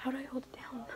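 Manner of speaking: soft unvoiced whisper. Real, not acted. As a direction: whisper the question under your breath. Hushed backstage costume fixing.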